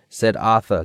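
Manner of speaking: ordinary delivery, nothing marked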